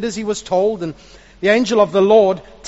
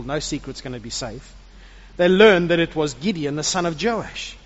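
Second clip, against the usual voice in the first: usual speaking voice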